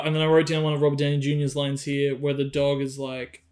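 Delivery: ordinary delivery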